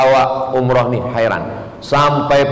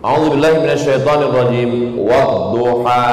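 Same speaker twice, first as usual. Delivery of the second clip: Quran recitation; 2 semitones lower than usual. Acted.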